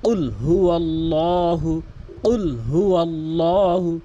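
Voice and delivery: কুল হুয়াল্লাহু drawn out slowly in chant; slow Quran recitation. Shown as a demonstration. Teacher reciting for students to repeat, slow chant. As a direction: recite it slowly, melodic tilawat. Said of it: "আমার সাথে বারবার practice করবেন".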